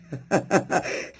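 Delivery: breathy triumphant chuckle; voiceless, gloating laughter; scornful laugh. Real, not acted. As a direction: laugh mockingly at the rival builders. Boastful laugh after architect claim.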